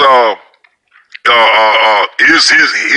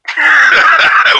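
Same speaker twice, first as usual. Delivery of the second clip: big laugh. Real, not acted. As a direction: crack up at your own story. Laughs after story punchline.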